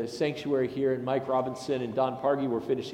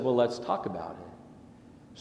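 calm reply; 6 semitones lower, 6 dB quieter, slower than usual. Retold or imitated. Calm reply to pastor friend.

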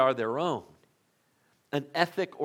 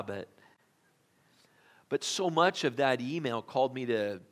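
normal talking voice

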